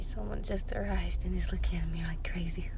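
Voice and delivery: hushed aside so no one hears; quick whisper to the phone. Real, not acted. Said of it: "I'm talking to my phone".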